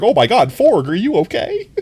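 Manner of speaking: high, loud fretting over a pet; hammed-up worried cry; playful panic, pitch swooping up; mock alarm. Mock alarm over squeezed Forg.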